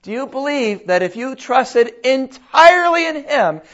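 ordinary talk

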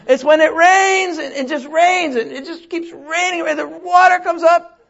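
high, near-shouted explanation; exasperated Noah. Acted. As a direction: Noah losing patience with his wife. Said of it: "He said".

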